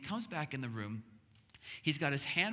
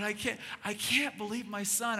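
excited dad; 6 semitones higher than usual. Retold or imitated. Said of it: "I got so excited".

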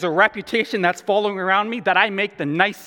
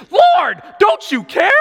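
high, loud indignant shout; ticked-off Martha; 14 semitones higher, 10 dB louder, slower than usual. Acted. Martha interrupting Jesus over kitchen work, ticked-off shout. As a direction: holler it in exasperation. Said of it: "She's just ticked".

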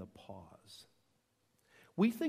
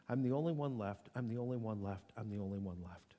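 low repetitions, each softer and lower; fading refrain; 6 semitones lower, 5 dB quieter, faster than usual. Acted. He had bought into the lie, murmured repetitively. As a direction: let the repeated lie trail downward.

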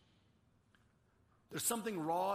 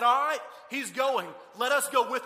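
rallying shout; 5 semitones higher, 11 dB louder than usual. Retold or imitated. Preacher voicing Thomas rallying the disciples, loud rousing shout.

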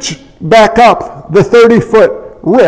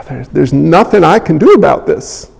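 helpless sigh; 7 semitones lower, 4 dB quieter than usual. Retold or imitated. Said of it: "You're going".

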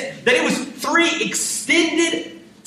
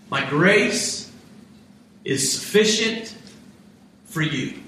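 solemn voice of God; 7 semitones lower, slower than usual. Acted. God's answer quoted.

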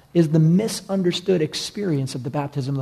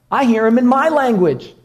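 higher, louder, thrilled discovery; amazed; 7 semitones higher, 5 dB louder than usual. Retold or imitated. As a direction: call it out like a stunned pilgrim. Crowd amazed at hearing tongues.

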